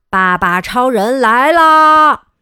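loud, slow grand entrance call; loud, slow hero entrance shout; heroic announcement. Acted. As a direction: announce the hero's arrival.

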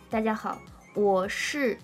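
usual speaking voice

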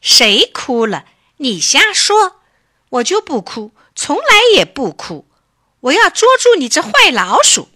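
loud, high indignant kitten outburst; angry shouting. A narrator's reading. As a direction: shout back hotly like an offended child. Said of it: "大声叫着".

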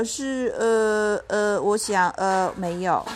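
halting, hesitant admission; unsure child, stalling between words; stammering. A narrator's reading. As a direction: grope for the answer with drawn-out ers. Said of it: "我结结巴巴地说".

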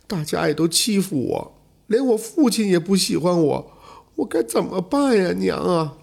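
high-pitched, mock-crying little boy's wail; tearful lament. A narrator's reading. Boy kneeling before his mother's altar, acted tearful lament. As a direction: plead in a high, plaintive child's voice, as if crying. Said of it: "大声地哭道".

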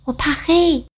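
high, small scared cub voice; a timid little cub afraid of the dark; little bear voice. Acted.

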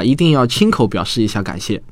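ordinary delivery

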